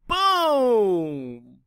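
loud drawn-out boom; a loud, long explosive boom. A narrator's reading. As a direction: make the explosion big. Explosion word emphasized.

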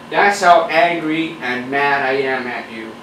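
usual speaking voice

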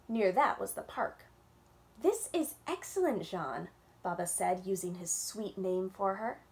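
gentle storytime read-aloud with little voices; reading to children. A narrator's reading. Librarian reading a picture book to kids on Zoom, storytime voice.

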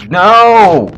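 high loud cry of no; frustrated scream. Real, not acted. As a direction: yell in exasperation. Dies again.

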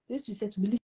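ordinary talk

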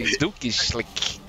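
slow, low German-accented catchphrase; mock German accent. Shown as a demonstration. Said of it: "we say it in a German accent".

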